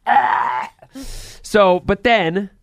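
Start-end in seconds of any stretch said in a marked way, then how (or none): 0.0-0.7 high, louder "ah" of frustration; mock yell. Host re-enacts watching alone in the room, mock yell. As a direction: let out a short, hammed-up cry. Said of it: "I'm, like, in my room going".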